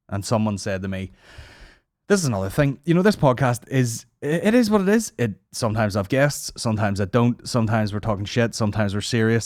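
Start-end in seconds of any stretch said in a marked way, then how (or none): none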